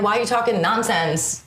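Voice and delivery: ordinary talk, nothing marked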